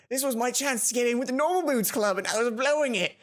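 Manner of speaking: mock British accent; fake British accent. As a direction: read the panic like a posh English schoolgirl. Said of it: "she's fake British accent as well".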